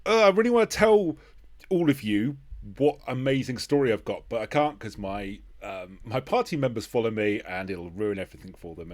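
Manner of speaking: mock the bursting urge to share, then the sigh of restraint; frustrated player quote. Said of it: "when they go".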